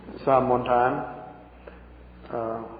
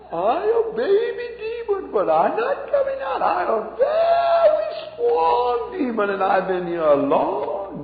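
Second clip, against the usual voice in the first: put-on squeaky, much higher, slow, childish demon voice; baby demon voice; slower than usual. Demon defying the praying pastor, high slow squeak. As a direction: pitch way up and drag each word like a cocky little demon. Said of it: "that's the way he talked".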